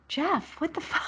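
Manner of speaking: high, hushed mock disbelief; softly aghast imitation; shocked coworker. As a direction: murmur it high, as an appalled bystander. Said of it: "one of his workers is like".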